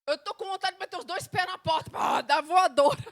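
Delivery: loud, high venting that bursts on the last words; angry prayer. Acted. Believer venting to God about kicking doors, acted fury. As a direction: build to an explosive flying-kick finish. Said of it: "Eu tô brava".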